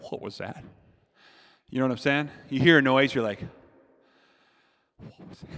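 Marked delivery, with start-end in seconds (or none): 0.1-0.6 a low, hushed question in the dark; softly spooked question; hushed "what was that". Retold or imitated. Lying in bed, hearing a noise. 5.0-5.5 a breathless whisper of "What was that?"; frightened whisper in the dark. Retold or imitated. Hearing a noise in bed.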